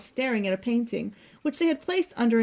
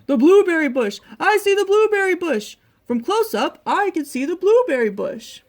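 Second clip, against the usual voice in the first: excited loud shout, higher pitch; Bear; 7 semitones higher, 8 dB louder than usual. A narrator's reading. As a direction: shout out a happy discovery. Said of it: "he shouted".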